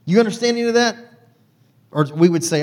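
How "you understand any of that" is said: high, loud teasing question; dad asking with a mocking lilt; dad's teasing question. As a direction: needle the kids about the sermon. Dad quizzing kids after church.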